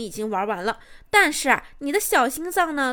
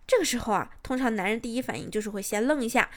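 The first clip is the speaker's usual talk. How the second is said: ordinary talk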